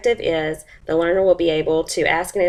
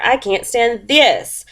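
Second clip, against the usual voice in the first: slow, higher, with "this" stretched out; stress on THIS; 4 semitones higher, slower than usual. A narrator's reading. Mister Bear bothered by airplane noise, stressing "this". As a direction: drag out "this" in fed-up protest. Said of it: "So for this, he's talking about".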